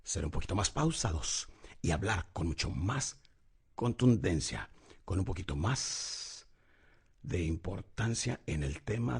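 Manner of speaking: slow, low, soft and weighty. Shown as a demonstration. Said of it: "debemos de aprender a bajar la voz". (voz baja, pausada y contundente)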